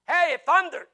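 loud, high mock exclamation; clueless crowd member. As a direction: call out like an excited bystander. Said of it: "The crowd that stood there".

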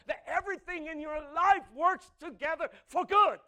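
loud, high preaching, slow on the key lines; preaching at full force. Acted. Preacher proclaiming to congregation, loud and stretched. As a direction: drive home the promise to the congregation.

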